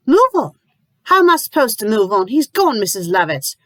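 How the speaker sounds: high, loud, fast disbelieving cry; incredulous outburst. Acted. Lucy furious at being told to move on, high loud outburst. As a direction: explode in disbelief at her.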